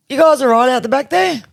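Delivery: high called question to the back; calling out. Retold or imitated. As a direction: call out to the group.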